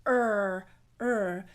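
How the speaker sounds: hard rhotic "Er", tongue sides pushed against the teeth; strong R. Shown as a demonstration. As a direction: hold a strong R in each separate "er". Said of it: "sides of the tongue push against the teeth".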